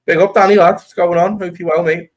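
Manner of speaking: high, loud hype greeting to a mate; big it up loudly for Danny; excited shout-out. Real, not acted.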